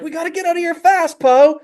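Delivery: high-pitched, panicky urging; urgent alarm. Acted. Trapped companion to Po, urgent high alarm. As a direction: push for escape, very high voice.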